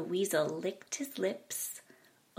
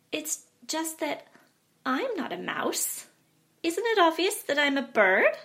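bat insisting, voice pitched up; high bat voice; 7 semitones higher, 6 dB louder than usual. A narrator's reading.

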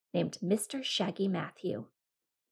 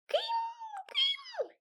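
high squeaky little voice; squeaky rabbit; 7 dB quieter than usual. Acted. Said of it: "he squeaked".

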